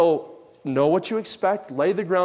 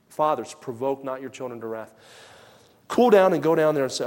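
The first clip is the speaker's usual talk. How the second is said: usual speaking voice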